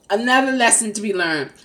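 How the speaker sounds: ordinary delivery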